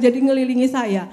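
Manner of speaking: ordinary talk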